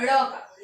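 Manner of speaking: ड़ flapped, tongue flicked up to the palate; ड़ sound demo. Shown as a demonstration. Teacher showing pupils how to say ड़. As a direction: flap the tongue up for ड़. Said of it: "इस चीज का उच्चारण करते हैं".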